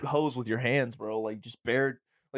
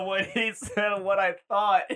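high, laughing echo; real laugh through the words; 6 semitones higher, slower than usual. Real, not acted. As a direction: repeat it while cracking up. Cracking up at the story.

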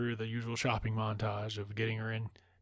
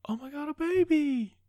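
much higher squeal of delight; gushing over a baby; 4 dB louder than usual. Retold or imitated. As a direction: coo like you've just seen your first baby. Diana sees a baby, quoted.

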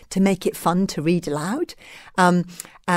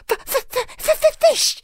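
very high, squeaky creature stutter; high-pitched stuttering little dragon; stammering baby dragon. A narrator's reading. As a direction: stammer it out as a tiny demanding dragon. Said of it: "hissed Toothless".

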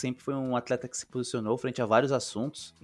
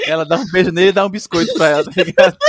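laughing; 7 semitones higher, 15 dB louder, faster than usual. Real, not acted. Joking story, laughing along.

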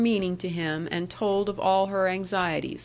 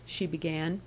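ordinary delivery

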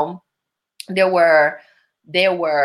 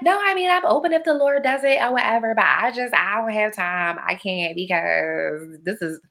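high whiny foolishness, sinking as it goes; talk foolishness voice; 5 semitones higher than usual. Retold or imitated. Telling girlfriends she has no time, foolishness voice. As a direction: ramble in a silly put-on excuse voice. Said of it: "that's my talk foolishness voice".

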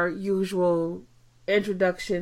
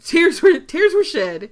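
louder, higher emphatic echo; 9 semitones higher, 9 dB louder, slower than usual. Real, not acted. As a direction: stress it with feeling.

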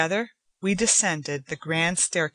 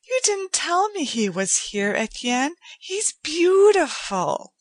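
put-on much higher delighted young woman, slowing at the end; Joanna, admiring; 10 semitones higher, 3 dB louder than usual. A narrator's reading. Joanna gushes to Etienne about the cat, much higher and delighted. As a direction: gush over the cat.

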